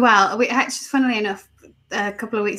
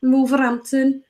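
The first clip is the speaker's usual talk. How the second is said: high Brummie twang; name it like a nasal Brummie local; nasal Birmingham accent; 4 semitones higher than usual. Acted. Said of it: "'cause very nasal".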